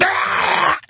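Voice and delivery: very high, piercing held-in scream; imitated shriek. Husband imitating wife's vomiting, piercing shriek. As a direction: imitate a possessed woman screaming. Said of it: "possessed by freaking Beelzebub".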